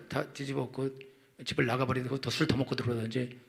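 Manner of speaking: ordinary talk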